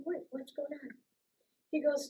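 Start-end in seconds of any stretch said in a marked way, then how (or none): 0.0-0.9 whispered, much softer; hushed question. Retold or imitated. [name] answering the sergeant's quiet call, hushed. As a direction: ask under your breath. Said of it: "I said".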